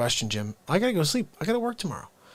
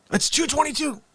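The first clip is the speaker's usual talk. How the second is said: surprised; 4 dB louder than usual. Real, not acted. Noticing the clock time.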